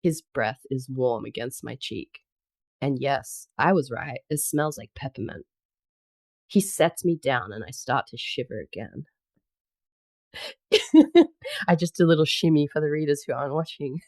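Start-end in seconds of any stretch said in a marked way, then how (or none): 0.0-9.1 composed romance audiobook reading; story narration. Acted. Reader telling of snow monster carrying heroine, story narration. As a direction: read the tale in measured phrases, settling at the ends. Resumes reading after laughing.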